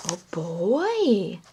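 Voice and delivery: playful hide-and-seek voice; reading to children. Acted. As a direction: coax the child to look. Reading picture book to toddler.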